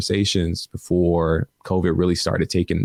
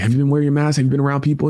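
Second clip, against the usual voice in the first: urgent retold question; 7 semitones higher, 3 dB louder, much faster than usual. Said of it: "I was like".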